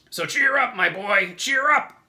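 high, very loud rallying shout; hearty centipede cheer. A narrator's reading. Centipede cheers up frightened James, loud high shout. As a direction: shout it to buck him up. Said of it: "the centipede said".

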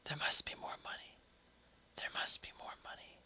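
hushed, voiceless whisper; eerie whisper. A narrator's reading. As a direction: whisper it like a secret haunting the house. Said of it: "a voice would start whispering".

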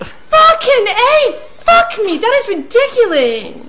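laughing, crying out high and loud; real excited shout. Real, not acted. Gamer erupting at a lucky goal, laughing shout. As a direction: yell it half laughing at the screen.

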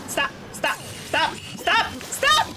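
stops rising to very high; stop building to shriek. Real, not acted. Gamer begging the fight to stop, rising to a shriek.

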